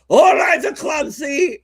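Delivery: high shouted bad Irish cop accent; terrible Irish accent. Cop on the radio again, shouting a terrible Irish accent. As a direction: bark it in a fake Irish brogue. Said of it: "every once in a while you'd hear".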